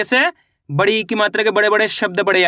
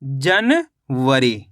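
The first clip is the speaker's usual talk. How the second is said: January as a long जन, a pause, then वरी; slow split reading; 6 semitones lower, much slower than usual. Shown as a demonstration. Teacher reading back the finished word, slowly split in two. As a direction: read January very slowly in two separated parts. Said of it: "तो मिलके बन गया".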